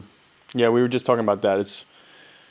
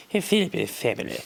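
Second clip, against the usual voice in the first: put-on floppy-jawed posh English royal accent, pitched higher; mock the royals with a loose, jelly jaw; royal family accent; 4 semitones higher, 5 dB quieter than usual. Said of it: "that royal family accent is like their jaws are made of jelly".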